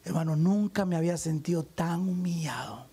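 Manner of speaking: confesión susurrada y dolida; humillado. Real, not acted. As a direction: casi en susurro, con vergüenza. Recuerda la humillación de niño. (el pastor confiesa su humillación, en susurro dolido)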